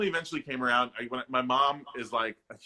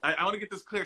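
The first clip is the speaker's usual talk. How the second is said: ordinary talk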